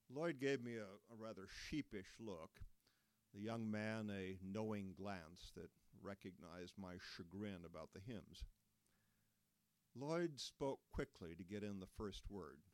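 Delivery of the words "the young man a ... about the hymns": plain reading voice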